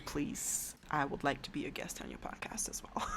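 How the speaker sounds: soft unvoiced aside; whispering. Real, not acted. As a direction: whisper it like a secret. Joking aside to hosts.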